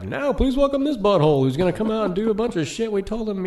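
high, loud sneering announcer voice; mock emcee voice. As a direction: mimic a snide host introducing you. Mocking the host's introduction.

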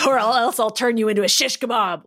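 mildly growled warning; growled threat. A narrator's reading. Logan defends Ryan from Sabretooth, lightly growled. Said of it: "Logan growled".

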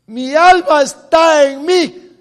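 loud, slow, drawn-out scripture; slow fiery preaching. Acted. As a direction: proclaim it slowly at full voice. Preacher at full force.

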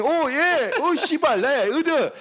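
high mock tough-guy swagger; mock gang swagger. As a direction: ham up the goons strutting in. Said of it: "they're fucking extra fun kicking it".

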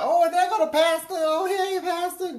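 mock sugary greeting to the pastor; high fake pious church lady. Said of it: "talking about".

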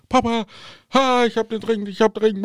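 high, hurried daughter's voice; daughter in a panic. Retold or imitated. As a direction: play the flustered daughter on the phone. Tochter ruft aufgeregt an. (aufgeregte Tochter, hohe Stimme)

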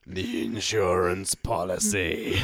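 low, slow ominous naming; lightly voiced villain, low and slow; mock villain phrase. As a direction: drop into a sinister villain voice. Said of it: "as the Master calls it".

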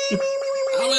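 high-pitched mewling horn noise; little horn bleating high; puny car horn imitation. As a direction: mimic a tiny, feeble car horn. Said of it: "he had a little bitch horn".

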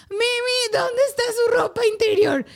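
put-on high kid's voice asking for underwear; mock child asking.

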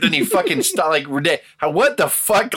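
normal talking voice

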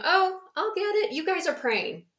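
a lightly voiced eager girl; little girl voice. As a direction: play a helpful little girl.

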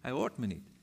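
hushed, hopeless repeat of hij hoort me niet; a broken whisper of hij hoort me niet; quiet despair. Retold or imitated. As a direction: let hij hoort me niet fall away softly. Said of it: "ik had het gevoel".

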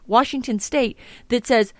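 ordinary talk, nothing marked

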